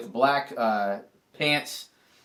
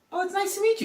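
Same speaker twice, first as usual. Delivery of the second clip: much higher, sweet-greeting voice; imitating bachelorette; much faster than usual. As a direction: pitch it way up as her polite hello. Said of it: "Hannah was like".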